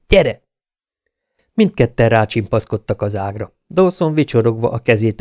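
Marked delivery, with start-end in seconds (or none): 0.1-0.4 high, loud, impatient call. A narrator's reading. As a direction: urge him on sharply. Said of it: "felelte türelmetlenül Wyatt". (türelmetlenül sürgetve)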